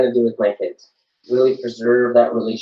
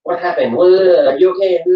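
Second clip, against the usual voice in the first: higher, louder worried questions; imitating anxious grilling; 6 semitones higher, 5 dB louder than usual. Mother imitating the grilling she avoided, higher and louder. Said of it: "I didn't investigate".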